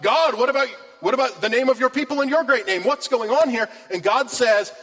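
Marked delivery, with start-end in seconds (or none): 0.0-3.7 pleading cry to God, pitched high and louder; desperate Joshua. Retold or imitated. Joshua pleading with God after defeat, high and anguished. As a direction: beg God for answers in panic. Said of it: "Joshua even says to God".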